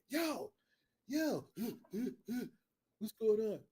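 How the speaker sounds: calling out. Retold or imitated. Man at the bedroom calls out to roommate, calling out. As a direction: call for someone's attention.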